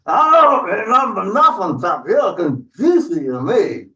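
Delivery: high, blustering troll voice; hungry troll. Acted. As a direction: brush them off as a greedy troll. Troll dismisses the goat's warning.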